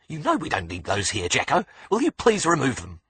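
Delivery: exasperated Australian nurse, rising high; indignant nurse. A narrator's reading. Nurse scolding Jacko about the cuffs, indignant and high. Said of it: "in a voice full of indignation".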